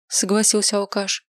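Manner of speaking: ordinary delivery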